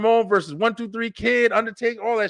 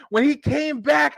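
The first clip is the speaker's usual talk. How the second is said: ordinary talk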